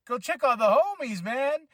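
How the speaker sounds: high, exasperated fan plea; mock pleading. Fan telling Rey to help his teammates, mock pleading. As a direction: urge him from the couch, half laughing.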